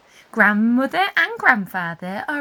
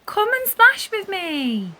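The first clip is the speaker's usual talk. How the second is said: eager call; 9 semitones higher, 4 dB louder than usual. A narrator's reading. Anna calls to cousins.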